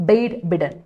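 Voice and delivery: normal talking voice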